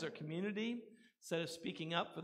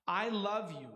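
louder, ringing declaration with weight on love; emphatic love; 3 semitones higher, 5 dB louder than usual. Acted. Preacher voicing Jesus' love for sinners, bold and ringing. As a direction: proclaim it boldly to the congregation. Jesus speaks to unlovable people.

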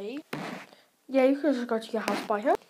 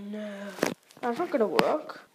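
ordinary talk, nothing marked

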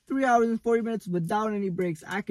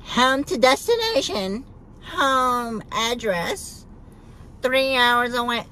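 mimicking maps app; 6 semitones higher, 3 dB louder, slower than usual. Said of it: "Maps says".

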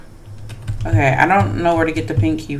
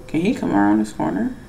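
ordinary talk

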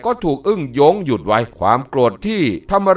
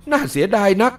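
disappointed cry; 6 semitones higher than usual. Acted. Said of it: "อึ่งหยงร้องบอกว่า".

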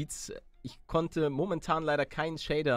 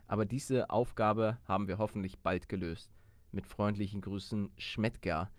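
etwas tiefer, gemessener Vorleseton; Brief vorlesen; 4 semitones lower, 4 dB quieter than usual. Acted. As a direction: trag den Befehlsbrief ruhig und förmlich vor. Liest den Brief im Spiel vor. (liest dem Mitspieler den Brief des Kommandanten vor, förmlich)

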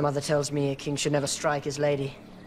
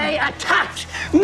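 shouting; 9 semitones higher than usual. Acted. King argues with his grandfather.

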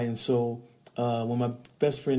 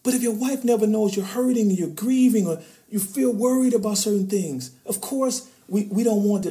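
raised, loud, urgent sermon voice; preaching at full force; 10 semitones higher, 6 dB louder than usual. Real, not acted. Speaking to men in the audience, fired-up preaching. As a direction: drive the point home like a preacher.

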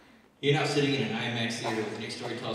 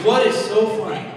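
loud, higher mock teacher scolding; mock angry teacher; 11 semitones higher, 18 dB louder than usual. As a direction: snap at the giggling class. Teacher snaps at laughing class.

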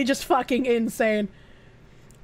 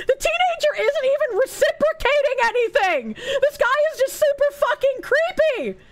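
high, strained cry of disbelief; exasperated outrage; 9 semitones higher than usual. Real, not acted. Streamer appalled at a creep's messages, exasperated outrage. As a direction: protest in a high-pitched, appalled voice.

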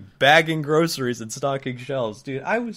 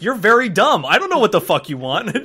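louder, higher insult; mock angry retort; 5 semitones higher, 6 dB louder than usual. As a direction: snap back loudly at the customer. Retold insult back at customer.

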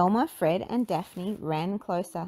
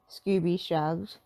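ordinary delivery

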